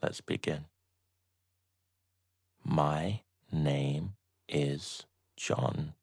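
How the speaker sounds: ordinary delivery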